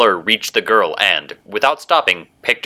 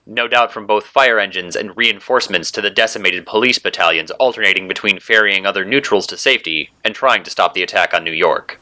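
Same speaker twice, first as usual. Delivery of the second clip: usual speaking voice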